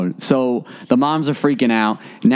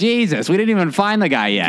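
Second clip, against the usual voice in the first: exasperated; 7 semitones higher than usual. Retold or imitated. Speaker's exasperated reply.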